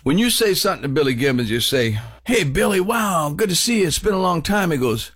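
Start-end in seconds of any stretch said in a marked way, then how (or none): 2.2-4.7 high-pitched, eager hello; excited fan greeting. Acted. Fan to Billy after a long time, excited greeting. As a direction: greet an admired musician with raised, wowed pitch.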